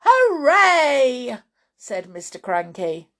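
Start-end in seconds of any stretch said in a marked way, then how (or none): 0.0-1.4 loud, high whoop of joy; gleeful cheer. Acted. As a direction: cheer it out in triumph. Mister Cranky rejoices at the disappearance.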